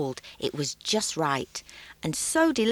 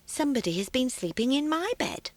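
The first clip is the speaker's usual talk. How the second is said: higher, gentle mother bear; mildly voiced gentle mother; a soft, gentle mother bear; quiet, gentle voice; 6 semitones higher, faster than usual. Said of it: "her quiet, gentle voice".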